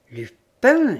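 loud, high outburst; sudden loud exclamation; exclaimed. A narrator's reading. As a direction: cry the name out, startled. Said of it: "s'écria-t-il".

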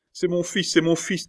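high, imploring cry; mother's cry. A narrator's reading. Mother crying out for her threatened son, high cry. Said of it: "cria la mère".

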